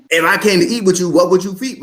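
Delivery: animated repeat. Shown as a demonstration. Coach repeats God's question to show its impact, animated. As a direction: throw the question out, lifted and lively.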